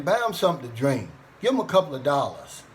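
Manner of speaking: ordinary talk, nothing marked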